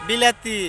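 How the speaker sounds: বিলাতি in broad Sirajganj dialect; Sirajganj dialect word. Shown as a demonstration. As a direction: give বিলাতি the Sirajganj way, with clear emphasis. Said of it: "মিষ্টি কিমড়ারে বলি".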